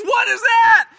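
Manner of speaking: high, loud cry of alarm; startled shout. Acted. Simon as the boat suddenly lurches, startled shout. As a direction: yell it out in astonishment. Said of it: "And he goes".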